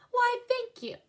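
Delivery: high, bright surprised thank-you; pleased thanks. Acted. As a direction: accept the compliment with delight. Viola thanks customer.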